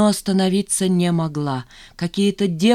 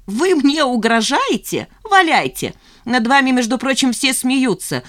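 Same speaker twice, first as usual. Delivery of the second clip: high, challenging retort; defiant; 6 semitones higher, 3 dB louder than usual. A narrator's reading. Zhanna defying the chief accountant's threat, challenging. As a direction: dare her to go ahead, pitched up. Employee defies threatening boss.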